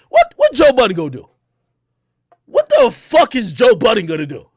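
loud, incredulous outburst; indignant challenge. Real, not acted. Ranter dismissing a threat of backup, loud indignation.

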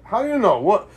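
high, indignant question; how-could-you-know disbelief; incredulous. Real, not acted. Disbelief at partner's claim.

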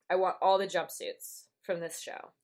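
normal talking voice

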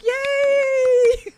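high, loud gleeful yay; excited cheer. Real, not acted. Cheering her friend's new gimmick, high loud excited yay. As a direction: cheer like your friend just won.

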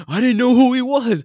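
high, loud imitated excuse; loud retold protest. Friend excusing himself after the near kidnapping, loud protest. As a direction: blurt out a mock-defensive yell.

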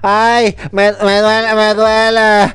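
slow, whiny pained groaning; mock complaining. Miguel after football whining about aching legs, hammed-up moaning. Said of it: "que se quejara".

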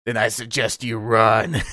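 low, slow, deadpan threat; a stern dad, slow and low; Carl Winslow threat. Retold or imitated. Carl Winslow warning his son, low and slow. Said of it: "He goes".

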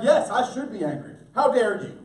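loud, hammed-up outrage; mock indignation. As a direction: imitate someone huffily challenging God. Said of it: "we look at God and we're like".

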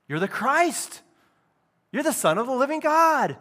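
exclaiming confession. Acted. Peter answers Jesus's question, exclaiming confession. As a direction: proclaim it with conviction, pitch lifted. Acted reply of the disciple Peter.

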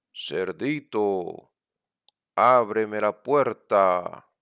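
slow, loud demanding wolf. Acted. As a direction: drag it out and roar the door line. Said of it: "rugiendo de hambre y gritando".

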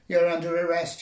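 loud robot cop barking orders; roaring policeman. Acted. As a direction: roar it through a megaphone. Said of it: "he roared through his megaphone".

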